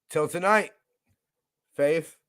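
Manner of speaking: ordinary talk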